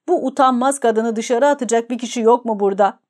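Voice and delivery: ordinary delivery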